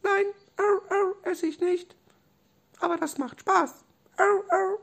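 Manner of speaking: tiny, very high squeak; squeaky Robby voice. Acted. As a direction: squeak like a small stuffed animal. Robby antwortet Doktor Löffel. (hohe, piepsige Stimme)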